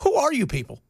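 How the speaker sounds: high-pitched bewildered questioning; baffled. Acted. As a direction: stammer out the question high, in mock disbelief. Baffled reaction to politics.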